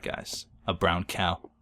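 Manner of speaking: ordinary talk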